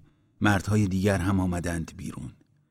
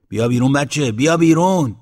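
loud call, climbing on the repeat; 6 semitones higher, 8 dB louder than usual. A narrator's reading. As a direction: holler to coax the child out. Headman waves lantern, calls again. (صدا زدن با صدای بلند)

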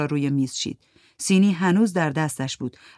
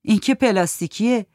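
Souri scoffing; 5 semitones higher, 4 dB louder than usual. Acted. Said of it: "سوری گفت".

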